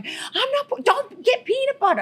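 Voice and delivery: high mock protest; squeaky voiced husband refusing; husband rejecting peanut butter; imitating husband. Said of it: "He's like".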